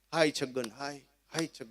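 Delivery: soft, breathy mourning chant of village women; mock wailing lament. Comedian mimics village women wailing for Chhagan. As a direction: imitate old women keening for the dead.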